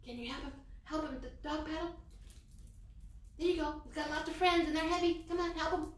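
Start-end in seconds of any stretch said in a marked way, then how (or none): none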